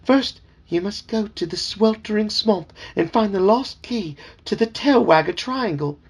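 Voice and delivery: high, fussy talking frog calling him sir; chirpy, insistent little frog servant; eager frog voice. Acted. Dad voicing the talking frog for his kids, high and eager.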